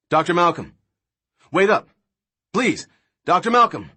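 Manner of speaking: loud and high, calling after someone. Acted. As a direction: shout across the courtyard to stop him. Levine calling across the courtyard.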